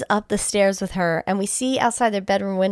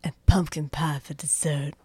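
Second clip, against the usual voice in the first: slow, lower mock mom voice; special voice; 3 semitones lower than usual. Friend doing a special voice for the movie mom's menu. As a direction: drag it out in a silly mom voice. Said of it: "I'm gonna do a special voice for her".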